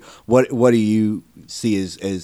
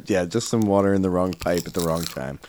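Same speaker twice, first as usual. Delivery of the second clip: usual speaking voice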